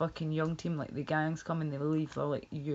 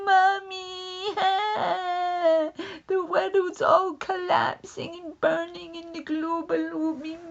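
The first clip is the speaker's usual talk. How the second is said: high wailing little-girl voice with fake sobs and laughs; mock a terrified crying child calling Mummy; hammed-up sobbing kid, high, loud and slow; 13 semitones higher, 5 dB louder, slower than usual. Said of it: "crying her eyes out, saying".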